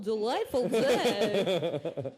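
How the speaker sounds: slow, drawn-out, laughing sign-off; mock-fancy sign-off. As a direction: stretch out a hammy radio goodbye. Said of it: "That's not a good way".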